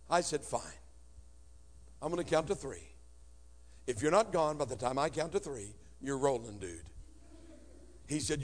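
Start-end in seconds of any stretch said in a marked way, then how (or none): 0.4-6.9 quiet menace before the push; low threat. Retold or imitated. Teen counts down to stepfather, quiet threat.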